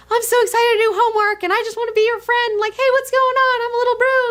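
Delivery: very high, eager little voice; Broo. Acted. Fan voicing a sweet comic character, very high and excited. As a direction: chirp it out like an excitable, friendly kid. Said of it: "he's just like".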